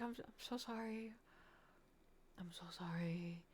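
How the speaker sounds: very soft, sinking to a whisper; mortified apology. Retold or imitated. Streamer to someone she forgot, mortified apology. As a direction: apologize in a shrinking, slowing whisper.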